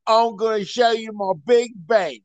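high Cockney cabbie spelling it out; thick Cockney, word by word for a baffled listener; exaggerated, deliberate Cockney enunciation; slowed-down Cockney. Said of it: "I'm gonna slow it down".

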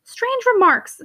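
high, scornful and disdainful; haughty scorn. A narrator's reading. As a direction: look down on him with scorn. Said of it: "so rarely haughty, looked so now".

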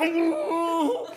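long, high comic hoot; mock high "ooh". Acts out his reaction.

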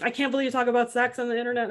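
fast, high Italian mother accent, lightly voiced; Italian mom accent. Mother scolding her son about sex talk online, in a quick Italian accent. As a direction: rattle it off like his Italian mom.

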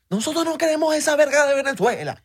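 very high, hammed-up protest; high-pitched Zulia regionalist parody; indignant maracucho. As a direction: demand it in a high, insistent caricature voice. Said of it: "maracucho que Alemania versus Zulia".

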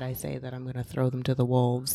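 ordinary delivery

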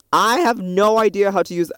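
loud, high exasperated complaint; frustrated outburst. Real, not acted. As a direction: throw up your hands at the app. Frustrated with GarageBand app.

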